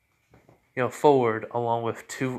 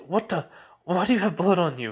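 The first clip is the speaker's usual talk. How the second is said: sudden high startled cry; shocked; 7 semitones higher, 3 dB louder than usual. Acted. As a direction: jolt up in alarm at the blood. Girlfriend sees blood on him.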